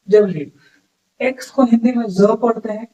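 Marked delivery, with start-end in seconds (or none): 0.1-0.5 डब्ल्यू said as "dub-lu", short and falling; clear demo of W. Shown as a demonstration. Teacher showing students the right way to say it. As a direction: clip डब्ल्यू into two syllables, "dub-lu". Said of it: "इसका सही pronunciation क्या होता है".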